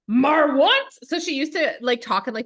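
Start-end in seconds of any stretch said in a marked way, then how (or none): 0.1-0.9 put-on 1 grumpy toddler. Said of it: "she's like".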